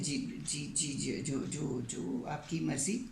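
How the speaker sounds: soft, slow stammering yes-yes; meek, scared compliance; frightened stammer. Retold or imitated. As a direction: trip over the words at gunpoint. Terrified singer at gunpoint.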